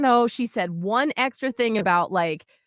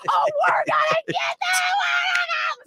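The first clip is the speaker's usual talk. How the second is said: retold screaming. Mom snapped at her daughter, screaming. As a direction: scream it as a threat, acting it out.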